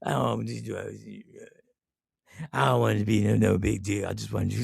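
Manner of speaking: low, soft mumbled impression; reluctant birthday boy. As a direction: mimic a friend shying from fuss, low and quiet. Voicing the birthday-shy friend's protests.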